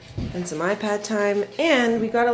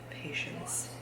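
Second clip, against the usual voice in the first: whispered self-reminder; 15 dB quieter than usual. Real, not acted. Mom steadying herself after a fight, whispering. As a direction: whisper "patience" with no voice at all.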